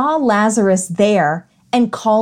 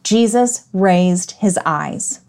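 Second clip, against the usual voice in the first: slow, spaced-out "Jesus raised his eyes"; "Jesus raised his eyes" said slowly, word by word; deliberate emphasis; slower than usual. Shown as a demonstration. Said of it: "are those four words".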